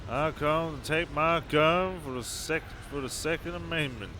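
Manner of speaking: mock Southern accent, badly done. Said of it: "That was a bad example of a Southern accent".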